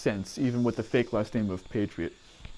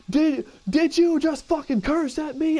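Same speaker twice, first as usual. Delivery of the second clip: drill sergeant; 13 semitones higher than usual. Retold or imitated. Drill sergeant yelling at recruit.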